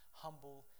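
usual speaking voice

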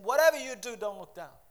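loud, high commanding cry from above; booming start, falling away at the end; God's voice. Acted. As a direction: call it out as God's urgent warning. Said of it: "God's voice saying".

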